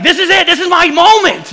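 high, rallying inner voice; mock heroic resolve. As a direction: play up the big heroic moment. Acting out his heroic inner thought.

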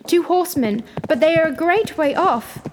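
high lookout's report; excited calling down. A narrator's reading. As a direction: call the news down urgently. Sister sights riders from tower.

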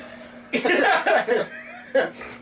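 airy high-pitched giggle; high breathy laugh. Real, not acted. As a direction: burst into a high wheezy laugh. Reacting to migraine joke.

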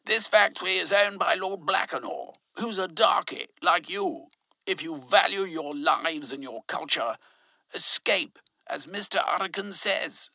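high, loud speech to the crowd; shouting. A narrator's reading. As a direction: rouse the crowd from a chair. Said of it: "leapt up on a chair and shouted".

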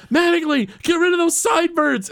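put-on high bossy old boss voice; mock Mr. Burns barking orders. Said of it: "Burns is like".

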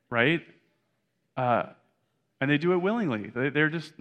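usual speaking voice

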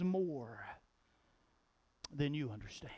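a breathy whisper of reproach; hushed reproach to Judas. Acted. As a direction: drop to a hush.

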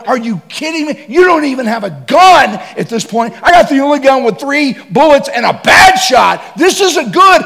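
high, loud frantic shout; panicked disbelief. Retold or imitated. Hunter panicking at unarmed guide, loud frantic shout.

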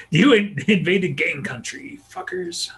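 high, laughing tough-guy sneer; mock gangbanger taunt. Gangbanger jeering at invading monsters, laughing sneer. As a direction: sneer it like a street thug mocking outsiders.

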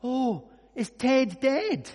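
high, loud, drawn-out naive astonishment; mock surprise. Friend to a mourner back from the funeral, mock-surprised. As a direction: ask it slowly, as if it just dawned. Friend's foolish question, acted.

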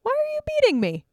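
a very high, hurt donkey voice; talking donkey. Acted. The beaten donkey asks its master why, in a high hurt voice. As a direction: squeak it out way up high, wounded. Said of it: "the donkey turns and looks to him and says".

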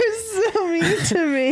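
high, drawn-out fake-hurt whine; mock whining. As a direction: whine playfully as if picked on. Partner whines after being cursed playfully.

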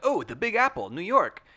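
high, loud mock delight; hammed-up thrilled recognition; imitated excitement. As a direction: exclaim it like an eager tourist. Quoting Victor's excited reaction.

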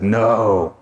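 low, curt rejection; Adam looking at cats, dry low no; flat dismissive no. Retold or imitated. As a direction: wave the cats off flatly. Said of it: "and he's like".

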